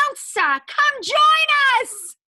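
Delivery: high, loud summons stretched out long; excited singsong holler for the kids; calling out. Pretend. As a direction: call her from far away, climbing to a big shout. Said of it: "Let's try that".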